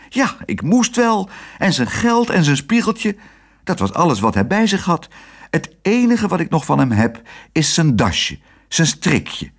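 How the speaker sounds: high-pitched, whiny confession; flustered nurse. A narrator's reading. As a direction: admit it in a high, helpless voice. Nurse admits it to director.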